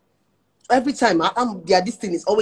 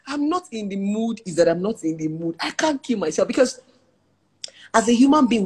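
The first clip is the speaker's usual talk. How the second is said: normal talking voice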